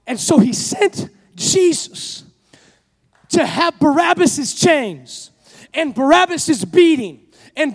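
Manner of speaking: loud, rising sermon climax; the preacher drives the crowd toward its climax; preaching at full force. Acted. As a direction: preach it to the congregation with fire.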